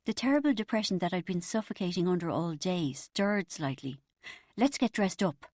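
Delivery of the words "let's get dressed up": plain reading voice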